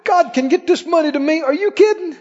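rising, high, eager praise; excited praise. Shown as a demonstration. Preacher voicing believers bragging on God, gushing. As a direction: gush over God like a delighted believer. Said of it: "talk that way and think that way and praise God and say".

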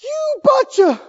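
high, loud mock outburst; exasperated Moses. Moses berating the people, high exasperated mock outburst. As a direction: lash out at the crowd, hammed up. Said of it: "What if Moses got up and said".

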